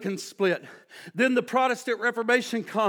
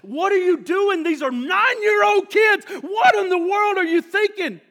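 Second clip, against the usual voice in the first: shouted high and loud at the fighting parents; retold scream; 9 semitones higher, 6 dB louder than usual. Pastor picturing himself shouting at brawling parents, loud and high. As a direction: yell it out to stop the crowd. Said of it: "I could have stood up and screamed".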